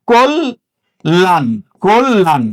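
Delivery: কোল / লান slowly drawn out, ল in both halves; syllable-split pronunciation. Shown as a demonstration. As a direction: sound out কোল, pause, then a slow লান. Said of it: "উচ্চারণ হবে". (ধীরে ভেঙে উচ্চারণ: কোল, লান)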